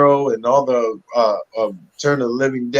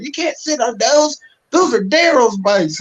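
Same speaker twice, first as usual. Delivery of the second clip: high, prissy mock voice of the crew; mock warning; 13 semitones higher than usual. Crew members warning the new actor off the bikes, high squeaky mock alarm. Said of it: "And they're like".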